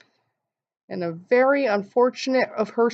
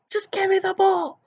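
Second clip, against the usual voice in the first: much higher, pleading whine for the bowl; whiny plea; 13 semitones higher than usual. Retold or imitated. Mom begging her daughter for the bowl, high whiny plea. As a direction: wheedle it like a kid begging.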